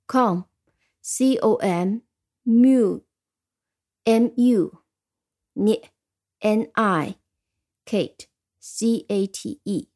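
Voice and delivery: slow spelling with a pause after each chunk; syllable-by-syllable spelling. Shown as a demonstration. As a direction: sound out each syllable, then its letters. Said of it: "我们一个个音节再来拼一遍".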